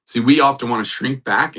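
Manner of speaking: ordinary talk, nothing marked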